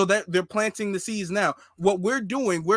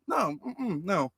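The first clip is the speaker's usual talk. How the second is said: mock refusal; 7 dB quieter, slower than usual. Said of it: "being all like".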